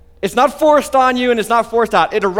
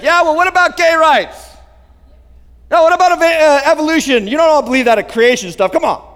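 higher-pitched, challenging comeback; mock argumentative unbeliever; 6 semitones higher than usual. Preacher imitating unbelievers wriggling out of a conversation, mock-argumentative. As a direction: throw out objections to dodge the subject. Unbelievers dodging talk about Jesus.

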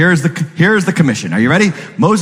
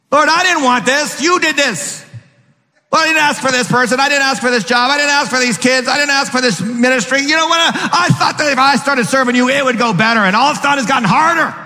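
complaining believer; 9 semitones higher than usual. Retold or imitated.

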